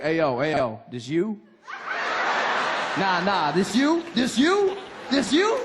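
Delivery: big grin hype man; cool American hype. Retold or imitated. Man greeting a stranger with a hyped-up compliment. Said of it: "just be like".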